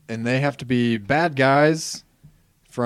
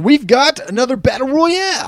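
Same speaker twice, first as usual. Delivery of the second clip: high, loud ring-announcer hype; hyped announcement; 13 semitones higher, 6 dB louder than usual. Real, not acted. Host announces the draft as another battle royale, hyped announcement.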